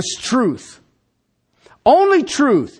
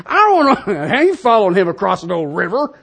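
mock the squeaky general through laughter; laughing mock voice; 3 semitones lower than usual. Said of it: "If I sounded like that".

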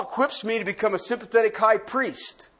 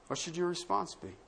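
the question asked again, much softer; hushed echo of the question; softer repeat; 11 dB quieter than usual. Shown as a demonstration. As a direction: let "What should your response be?" sink in quietly.

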